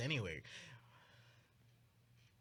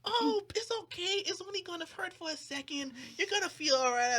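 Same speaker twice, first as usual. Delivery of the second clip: mock soothing friend; 13 semitones higher than usual. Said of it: "she's like".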